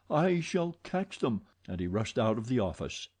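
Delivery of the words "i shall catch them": eager. A narrator's reading. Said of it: "cried the young man".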